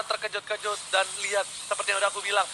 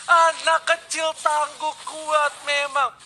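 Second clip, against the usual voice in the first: fighting game announcer; 6 semitones higher, much slower than usual. Acted. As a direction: stretch the name like a ring announcer. Hyped esports casting.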